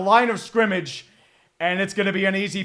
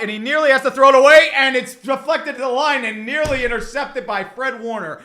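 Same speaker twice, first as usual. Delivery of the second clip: ordinary delivery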